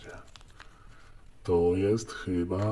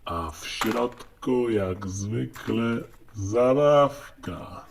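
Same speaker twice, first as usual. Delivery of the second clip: slow, building to the reveal; 2 semitones higher, slower than usual. Real, not acted. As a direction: draw out the suspense.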